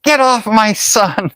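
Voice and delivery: shrill it out like an outraged mom; angry mom voice. Retold or imitated. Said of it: "And she said".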